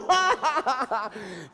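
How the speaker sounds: high, rapid cackle; mocking rabbit laugh. Rabbit laughs at own joke.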